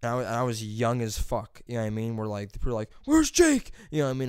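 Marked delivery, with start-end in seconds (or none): 3.1-3.7 calling out. Retold or imitated. People calling out for missing kid.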